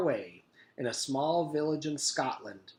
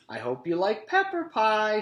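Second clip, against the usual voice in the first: cafeteria lady voice; 9 semitones higher, 6 dB louder than usual. A narrator's reading. Miss Mush voice.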